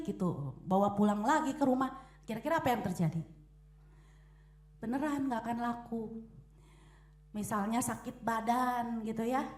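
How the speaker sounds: usual speaking voice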